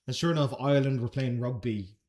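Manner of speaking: ordinary talk, nothing marked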